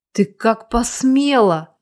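ordinary delivery, nothing marked

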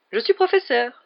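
high, loud astonished voice; étonné. A narrator's reading. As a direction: blurt it out in dazed amazement. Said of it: "s'étonna Lockhart". (étonnement aigu et sonore)

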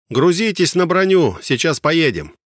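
loud command to load up; shouted order. Acted. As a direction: call it out to the troops.

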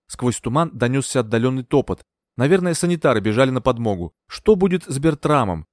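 usual speaking voice

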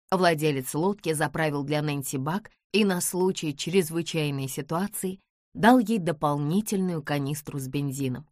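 plain reading voice

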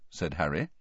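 ordinary delivery, nothing marked

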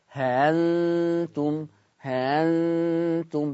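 ها أنتم very slow, the hamza eased between hamza and alef; tashil demo. Shown as a demonstration. Teacher models tashil for the class. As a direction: slowly ease the hamza in ها أنتم. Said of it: "ده التسهيل".

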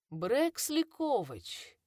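slow rasping inhuman voice; eldritch horror. Acted. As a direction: drag the name out like something ancient. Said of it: "скрипучий нечеловеческий голос".

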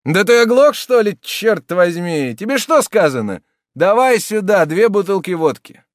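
very high, loud order; firm shout. A narrator's reading. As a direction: bark at the servant. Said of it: "твёрдым голосом крикнул слуге".

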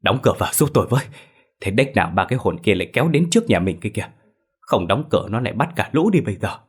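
rapid, breathless pleading; panicked hurry. Acted. Husband begging wife to shut the door, fast and frantic. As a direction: rush the words out in fear. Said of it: "lão giục mụ Hường".

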